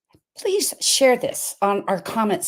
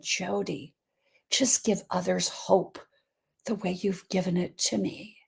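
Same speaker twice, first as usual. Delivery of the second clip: dying mother's whisper; 7 dB quieter than usual. Retold or imitated. Dying mother's last words retold.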